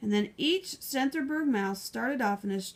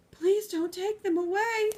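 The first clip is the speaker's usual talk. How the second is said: high, begging librarian voice; pleading librarian; 9 semitones higher, 3 dB louder than usual. Acted. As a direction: beg him not to take them. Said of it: "pleaded the librarian".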